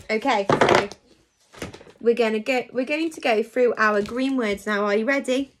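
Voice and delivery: normal talking voice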